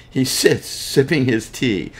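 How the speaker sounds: reading on with a laugh after the slip; laughing at own slip. Real, not acted. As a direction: chuckle through the corrected line.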